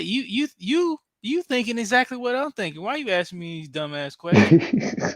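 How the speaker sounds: high-pitched imitation of an exasperated driver; sarcastic comeback. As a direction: voice his sarcastic thoughts, high then dropping. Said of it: "he wanted to be sarcastic".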